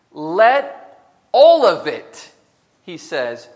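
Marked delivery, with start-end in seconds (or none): 0.1-2.3 thundering preacher. Real, not acted. Preacher booming the command at the congregation, very loud and slow. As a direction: let all of it, very loud and slow.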